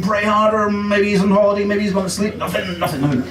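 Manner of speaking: usual speaking voice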